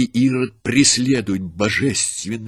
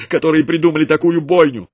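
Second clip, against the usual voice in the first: higher, louder horrified cry; frantic outcry; 6 semitones higher, 5 dB louder than usual. A narrator's reading. Frantic mother crying out at the soldier, high and loud. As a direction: demand it in horror. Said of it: "вскрикнула обезумевшая женщина".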